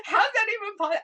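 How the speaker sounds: high, loud retold protest; hammed-up panicky disbelief; frantic disbelief. Wife protesting to her reassuring husband, frantic disbelief.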